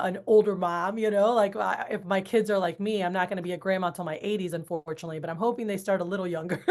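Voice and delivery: normal talking voice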